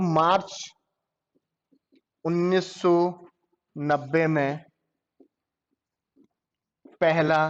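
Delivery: deliberate slow dictation with long gaps; slow spaced reading. Shown as a demonstration. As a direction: read it out slowly with long pauses between words. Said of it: "अगर मैं normally बात करूं".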